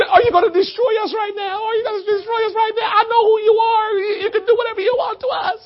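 a mock-frightened demon begging; terrified demon. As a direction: cower and beg in terror.

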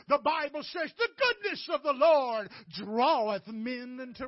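loud, high, driving pulpit voice; impassioned preacher hammering key words; full-force preaching. Acted. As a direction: build phrase by phrase to a shout.

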